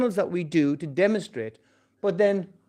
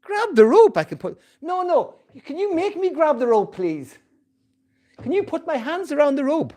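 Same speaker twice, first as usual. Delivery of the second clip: whiny helpless man; 10 semitones higher than usual. Retold or imitated.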